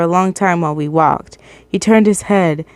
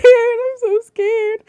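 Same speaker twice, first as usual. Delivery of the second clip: much higher, slow whimpering whine; mock whimper; much slower than usual. Reader hamming up fear, slow high whimper. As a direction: stretch it out like a scared child. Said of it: "repeats in mock voices".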